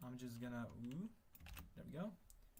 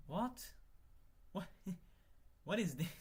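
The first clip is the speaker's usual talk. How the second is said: shocked what; 6 semitones higher than usual. Real, not acted. Sudden shock at code.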